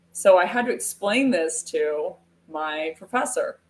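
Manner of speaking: normal talking voice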